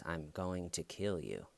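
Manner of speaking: soft, quiet retold threat. Girl to man waking in bed, soft mock menace. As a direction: say the threat softly.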